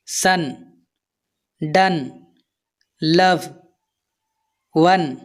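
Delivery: सन, डन, लव, वन with an open "uh" vowel. Shown as a demonstration. Teacher giving learners examples, "uh" vowel in सन, डन, लव, वन. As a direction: say सन, डन, लव, वन apart, O sounding "uh". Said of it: "कभी-कभी आ की तरह भी किया जाता है".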